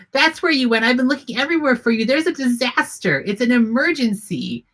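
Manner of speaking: high, rushed, breathless worry; frantic young elf woman. Acted. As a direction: gush at the chicken in a fluster. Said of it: "running over to the chicken and goes".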